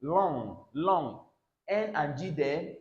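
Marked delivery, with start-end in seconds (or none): 0.0-1.2 "long" ending on ng, no hard g; "long" fading out on the nasal; silent g. Shown as a demonstration. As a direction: repeat "long" as a model, g unsounded. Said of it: "Never you pronounce the G sound there".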